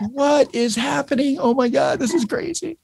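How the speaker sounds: high, slow disbelieving; astonished. Retold or imitated. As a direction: act out their shock he appeared. Coworkers shocked at his arrival.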